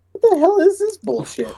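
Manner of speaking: a hammed-up outraged yelp; mock outrage. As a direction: act out the outrage with a high squeal. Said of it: "I'm like".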